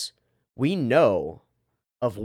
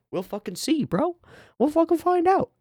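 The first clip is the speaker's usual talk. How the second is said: playful high voice. Real, not acted. Streamer teasing viewers about his prediction, playful high voice. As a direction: pitch jumps way up high.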